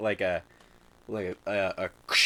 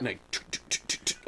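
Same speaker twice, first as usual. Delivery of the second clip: footstep noises; 10 dB quieter than usual. Shown as a demonstration. GM imitates running footsteps.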